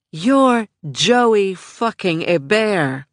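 slow, weighted, every word landed; firm and slow. A narrator's reading. Michelle tells Joey who she is, slow and firm. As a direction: drive it home word by word.

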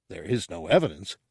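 quick, restrained, irritated reply; brisk, weary put-down; exasperated correction. Acted. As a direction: correct the fool briskly, keeping your voice down. Ortug's exasperated correction of Gartog.

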